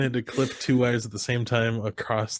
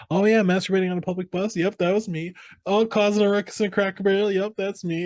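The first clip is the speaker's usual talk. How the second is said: breezy confession; 9 semitones higher than usual. Retold or imitated. Acting out character reviewing his arrest record.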